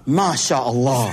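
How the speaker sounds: slow, drawn-out, low MashaAllah; imitated convert. Convert hears of a mugging, slow imitated praise. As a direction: stretch it out like an awed new convert. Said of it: "he looks up, he goes".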